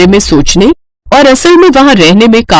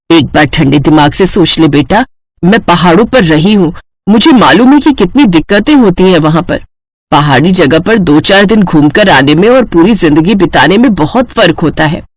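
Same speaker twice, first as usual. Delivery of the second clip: lower, measured motherly voice; cautious mother; 4 semitones lower than usual. Acted. Mother warns daughter about life in the mountains, cautious. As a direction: counsel your daughter calmly.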